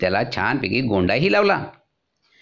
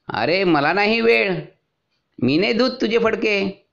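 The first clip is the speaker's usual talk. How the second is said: washerman refusing; 8 semitones higher than usual. A narrator's reading. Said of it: "धोबी दादा म्हणाला".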